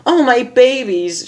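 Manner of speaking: high anxious mother's cry; distressed cry. Acted. Mother rushing to her crying babies, anxious cry. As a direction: cry out for your children. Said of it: "she cried".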